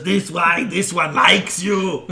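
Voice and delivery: high, loud, slow creature voice; excited lizard creature. Acted. Game master as lizard hanging over someone's head, loud creature voice. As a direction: blurt it in a loud, halting lizard voice. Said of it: "and says".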